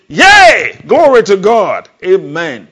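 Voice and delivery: demonstrating the congregation's shout of praise; joyful scream. Shown as a demonstration. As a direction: scream it out in triumph. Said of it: "scream. Say".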